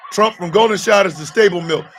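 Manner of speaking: ordinary talk, nothing marked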